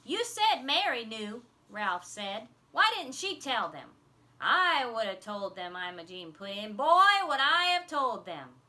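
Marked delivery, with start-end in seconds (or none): 0.1-1.5 loud slow accusing; boy challenging the teacher; indignant challenge. A narrator's reading. Ralph arguing with Mother. 2.7-5.8 loud demanding; indignant. A narrator's reading. Kids indignant at story. 6.8-8.6 loud high burst then slow stress on I; she'd have given them hell; girl boasting she'd have spoken up; fierce boast. A narrator's reading. Imogene boasting indignantly.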